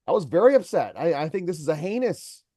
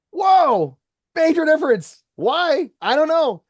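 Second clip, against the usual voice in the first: much higher and louder, wowed by the contrast; amazed exclamation; 10 semitones higher, 6 dB louder, slower than usual. Real, not acted. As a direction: marvel out loud at the difference. Amazed at his own demo.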